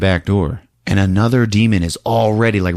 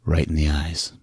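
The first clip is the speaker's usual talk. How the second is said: low cool threat; 4 semitones lower, 7 dB quieter than usual. Retold or imitated. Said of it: "looks at him and says".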